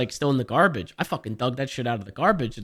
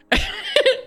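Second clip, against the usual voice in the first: high burst of laughter. Real, not acted. Laughing at her own stress.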